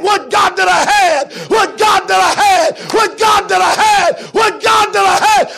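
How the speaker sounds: high, loud, slow "would God"; shouted regret. Acted. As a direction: cry the line out. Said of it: "chanted regret, loud take".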